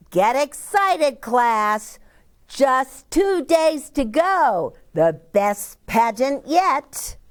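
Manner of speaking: excited teacher. A narrator's reading. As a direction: fire up the class like an eager teacher. Teacher rallies the class.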